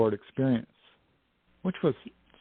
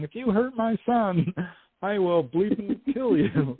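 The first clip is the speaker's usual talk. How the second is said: mock furious mother, higher and slower at the end; mother's threat; 9 semitones higher than usual. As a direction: threaten like a mama bear, half laughing. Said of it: "a woman screaming".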